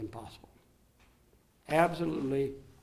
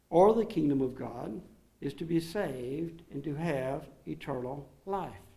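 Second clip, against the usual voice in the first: ordinary talk, nothing marked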